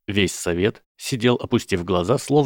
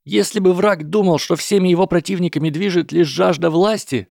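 put-on higher, earnest young hobbit; 9 semitones higher than usual. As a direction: argue urgently, pitched high. Frodo voiced by narrator.

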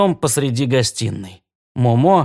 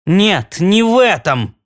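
higher-pitched, restrained yell; 6 semitones higher, 4 dB louder, slower than usual. A narrator's reading. Claude yells at ghostly Alvina, a contained shout. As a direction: snap back in a contained shout. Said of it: "заорал Клод".

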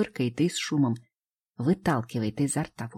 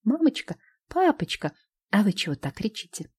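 put-on child voice; 6 semitones higher than usual. Calm child surprised at parents.

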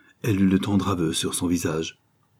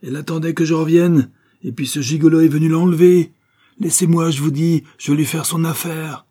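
high, loud furious rant; angry shouting; 9 semitones higher, 7 dB louder than usual. Acted. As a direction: struggle free and yell at the rival. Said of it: "criait-il". (hurler de colère, voix haute et forte)

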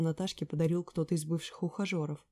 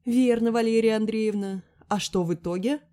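loud, higher stern grilling; menacing interrogation; 5 semitones higher, 8 dB louder than usual. A narrator's reading. Friend interrogates Lera by full name, stern and loud. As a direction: grill her like a judge. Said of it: "грозно допрашивала подруга".

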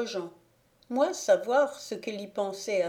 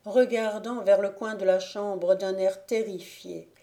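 ordinary delivery

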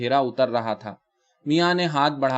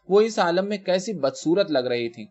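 usual speaking voice

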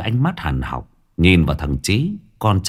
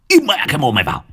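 high furious shout to shut him up; angry shout; 8 semitones higher, much faster than usual. Acted. Boss shouts down his timid underling, furious. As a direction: yell him silent.

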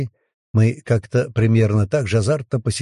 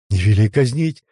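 blurted out; 3 dB louder than usual. A narrator's reading. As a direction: blurt it out in a rush. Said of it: "Первую фразу холмик выпалил".

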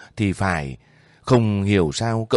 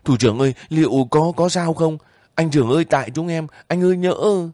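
high, anxious cry to the commander; desperate high-pitched plea; tearful pleading; 8 semitones higher than usual. A narrator's reading. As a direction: plead with him through tears. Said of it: "Tiếng khóc bỗng ầm lên".

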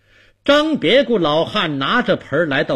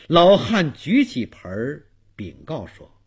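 plain reading voice

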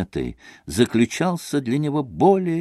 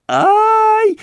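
put-on high startled shriek of an old woman; a sudden piercing old woman's cry; 6 dB louder than usual. A narrator's reading. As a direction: yelp high in fright. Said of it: "закричала старушонка".

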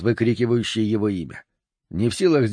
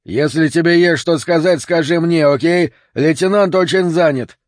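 raised, louder cop voice; irritated cop; 5 semitones higher, 5 dB louder than usual. A narrator's reading. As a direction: talk over a rowdy vagrant. Cop confronts a shouting vagrant. (раздражённо, на повышенных тонах)

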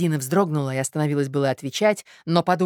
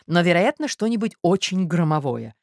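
plain reading voice

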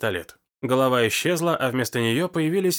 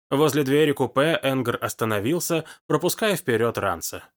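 ordinary delivery

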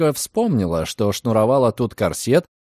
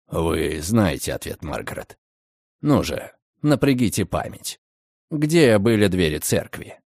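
low coaxing male voice; 6 semitones lower than usual. A narrator's reading. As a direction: gently push her to remember. Redfern coaxes her. (низкий подталкивающий голос)

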